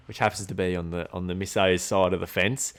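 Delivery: ordinary talk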